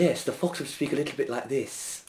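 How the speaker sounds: hushed, sly posh English fox; quiet posh English fox voice. Shown as a demonstration. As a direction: speak softly like a well-bred schemer. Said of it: "a bit posh, a bit sly".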